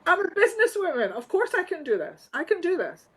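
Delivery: high, fast, breezy confidence; sassy self-quote. Retold or imitated. Answering doubting growers, high, fast and cheeky. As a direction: toss it off like a bold Scottish businesswoman. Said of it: "I said".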